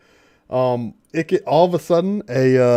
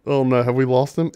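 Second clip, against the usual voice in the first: ordinary talk, nothing marked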